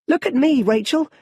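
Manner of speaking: put-on high, loud, gleeful little boy calling out; a small boy showing off to his friend; excited child. Young Bruce calls to Rachel from the well, excited and high.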